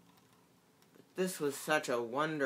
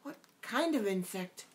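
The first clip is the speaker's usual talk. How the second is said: a little anxious; 7 semitones higher than usual. A narrator's reading. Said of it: "a little anxiously".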